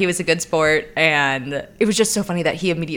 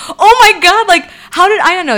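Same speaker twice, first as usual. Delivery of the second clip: much higher and louder, a laughing disbelieving cry; shocked outburst; 11 semitones higher, 9 dB louder than usual. Retold or imitated. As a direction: blurt it out in embarrassed disbelief. Retelling her own shocked reaction.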